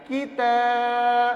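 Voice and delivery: short کی, then a long-held تا. Shown as a demonstration. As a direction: clip only کی and stretch تا. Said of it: "اس لیے یہ کھینچے گا". (کی چھوٹا، تا کھینچ کر)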